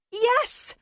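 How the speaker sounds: a sudden burst of joy, up high; eager high yes. Acted. April answering Harrison, eager and high-pitched. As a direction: shoot it out high and thrilled.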